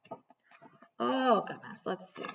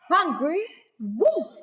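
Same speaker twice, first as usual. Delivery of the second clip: slow, drawn-out and higher; dramatic reveal; 7 semitones higher, 3 dB louder, slower than usual. A narrator's reading. Teacher revealing the wolf to children, slow and dramatic. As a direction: stretch out hungry wolf. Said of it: "reading with drama".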